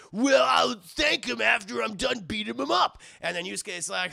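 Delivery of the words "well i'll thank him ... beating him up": loud, higher-pitched thug bravado; put-on shouted threat from a hothead; blustering tough guy. Rival's blustering threat in retelling.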